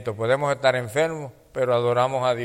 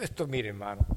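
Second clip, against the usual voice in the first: usual speaking voice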